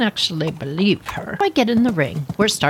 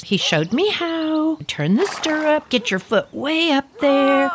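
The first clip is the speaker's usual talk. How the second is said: normal talking voice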